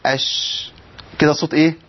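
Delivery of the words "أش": أش with a long hissed sh that fades by itself; أش drawn out, whispered sh; sustained sh demo. Shown as a demonstration. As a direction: hold the sh of أش until it dies out. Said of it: "أقول إيه؟".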